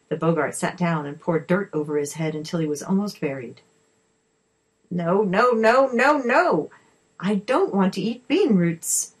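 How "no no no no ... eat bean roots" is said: loud, rising wail of protest; frustrated tantrum. A narrator's reading. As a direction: refuse like a creature throwing a tantrum. Boggart's tantrum over missing roots.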